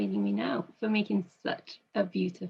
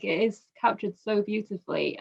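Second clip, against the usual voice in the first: ordinary talk, nothing marked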